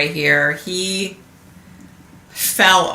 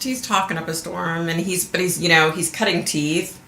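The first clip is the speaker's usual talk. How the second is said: normal talking voice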